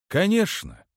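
high start with a sighing fall; mildly sighed agreement; sighing assent. A narrator's reading. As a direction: say it on a resigned, understated sigh. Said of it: "вздохнул Траун".

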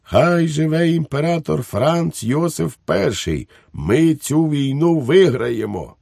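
high, proclaimed cheer for the emperor; exclaiming. A narrator's reading. Švejk cheers the emperor before the crowd, exclaiming. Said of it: "Швейк вигукнув".

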